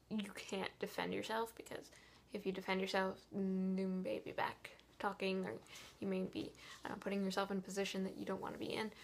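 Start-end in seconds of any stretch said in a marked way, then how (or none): none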